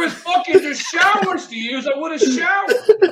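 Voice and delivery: high, loud laughing-crying rant; exasperated laughing rant. Real, not acted. Ranting to friends about the bathtub, loud laughing wail.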